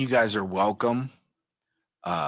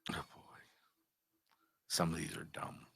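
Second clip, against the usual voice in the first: whispering; 3 semitones lower, 12 dB quieter than usual. Real, not acted. Quietly reading joke cards.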